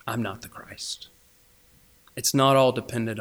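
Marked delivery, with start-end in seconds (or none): 0.1-1.1 hushed, breathy confession; whispered. Real, not acted. Preacher whispering to the congregation. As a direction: drop to a whisper for the key truth. Sermon confession, sudden hush.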